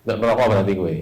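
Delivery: normal talking voice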